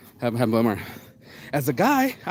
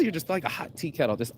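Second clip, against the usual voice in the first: ordinary delivery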